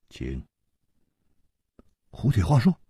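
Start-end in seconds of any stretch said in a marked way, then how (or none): 0.1-0.4 eerie low invitation. Acted. Crying-mask man offers coffin.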